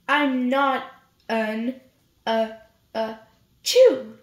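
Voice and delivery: stammering then a loud sneeze. Acted. Mouse stuttering, then bursting into a loud sneeze. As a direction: stumble over words, then sneeze loud.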